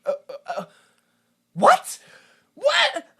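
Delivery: stammer, then a high, loud baffled what; mock-stunned stammering; high yelp of confusion after halting uhs. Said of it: "I just went".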